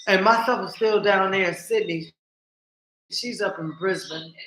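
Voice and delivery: normal talking voice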